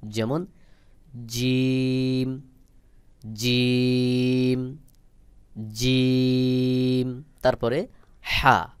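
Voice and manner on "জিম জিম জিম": জিম pressed hard from mid-tongue, held very long; firm tongue pressure on a slow, stretched জিম. Shown as a demonstration. Teacher models the Arabic letter, firm drawn-out জিম. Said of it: "একটু চাপ দিয়ে শক্ত করে".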